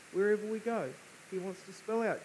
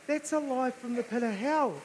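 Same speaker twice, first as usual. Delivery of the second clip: fervent preaching; 6 semitones higher than usual. Acted. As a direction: preach it hard to the room. Preaching at full force.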